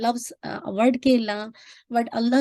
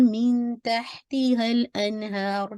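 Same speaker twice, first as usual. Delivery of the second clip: slow recitation; much slower than usual. Shown as a demonstration. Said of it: "टू कौन".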